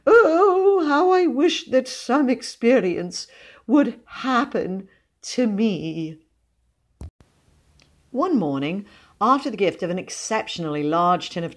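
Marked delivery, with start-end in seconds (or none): 0.1-6.2 high, loud wail sinking slowly; wistful sighing. A narrator's reading. Cousin longing for her own ghostly experience, wistful. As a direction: lament it with drawn-out longing, fading at the end. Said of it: "she sighed and stroked back her thin locks sadly".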